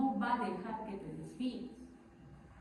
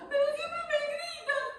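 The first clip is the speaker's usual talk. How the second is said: very high mock-whiny child; playing the victim; 4 dB louder than usual. Scolded child answers the parent as victim, high mock protest. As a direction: complain in a high, put-on wronged voice. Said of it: "es una víctima".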